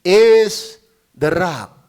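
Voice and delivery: very slow, drawn-out reading; preacher slowly savors the verse; slow proclamation. Acted. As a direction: stretch each word of the verse. Slow scripture.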